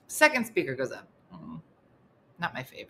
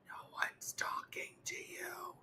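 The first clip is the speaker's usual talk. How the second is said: urgent whispered scold; 9 semitones higher, 18 dB quieter, slower than usual. Retold or imitated. Narrator hushing Missy, slow whispered scold. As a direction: hiss it slowly at her.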